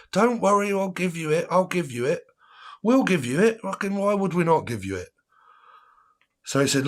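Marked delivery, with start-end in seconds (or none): 0.1-5.1 put-on high, pleading drunk voice; mock drunk promise. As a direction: overdo the insistent drunk reassurance. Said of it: "drunk mate promising to pay".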